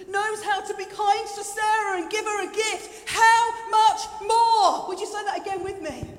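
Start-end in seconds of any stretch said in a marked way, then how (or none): 0.1-4.9 high, building to a loud, slow climax; impassioned preaching. Acted. Preacher using a congregant as example, impassioned climax. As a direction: preach up to a booming refrain.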